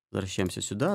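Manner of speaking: normal talking voice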